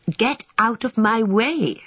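high, drawn-out order to clear off; brisk order. A narrator's reading. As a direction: shoo them off, stretching each word. Cook shooing boys from gravy.

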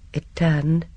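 plain reading voice

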